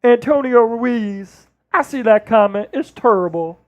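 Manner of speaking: Barkley impression. Keeps Barkley voice on chat comments.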